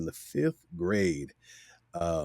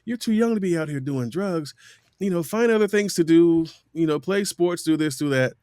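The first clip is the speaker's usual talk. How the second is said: higher, earnest public-service voice; a hint of announcer preaching; PSA ad read; 7 semitones higher than usual. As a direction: warn the kids like an anti-drug spot. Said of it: "but it".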